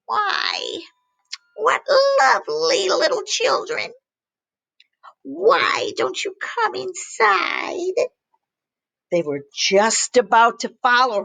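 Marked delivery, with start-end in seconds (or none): 0.0-8.0 high, slow sweet-sly witch. Acted. Old woman luring the children, slow high voice. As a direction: lure them in slowly. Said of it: "came out and said".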